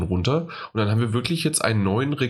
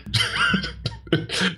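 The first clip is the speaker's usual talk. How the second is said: breaking into a high giggle; a burst of high laughter. Real, not acted. Laughs after partner.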